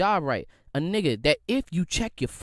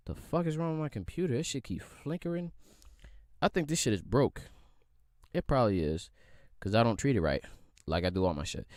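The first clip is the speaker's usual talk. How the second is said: quiet grumble; 10 semitones lower, 6 dB quieter than usual. Real, not acted. Grumbling at flickering computer.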